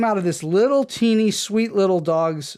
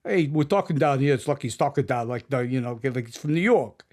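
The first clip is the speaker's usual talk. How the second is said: imitated New York cabbie talk; mock auditioning actors with a New York accent; put-on New York cabbie voice; New York cab driver accent; 3 semitones lower than usual. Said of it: "sort of a New York cab driver accent".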